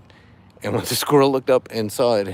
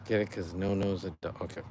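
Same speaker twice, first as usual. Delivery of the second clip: normal talking voice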